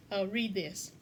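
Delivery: normal talking voice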